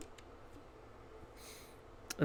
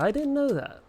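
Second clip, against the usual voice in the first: much higher, sing-out pitch; playful high-pitched repeat; 11 semitones higher than usual. Pretend. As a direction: swoop way up on the repeat. Repeats line in exaggerated high voice.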